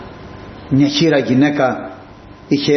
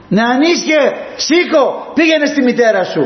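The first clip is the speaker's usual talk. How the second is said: higher, ringing command to rise; Jesus ordering the young man up, higher and bold; 10 semitones higher than usual. Acted. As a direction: call the dead boy back to life. Jesus raises the dead youth.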